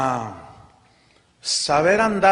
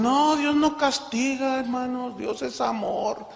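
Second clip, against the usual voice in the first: sugary mock believer; 7 semitones higher, slower than usual. Preacher mocking Christians who say God never punishes, sweet and high. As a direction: coo it like a naive churchgoer. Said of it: "Hay cristianos que dicen".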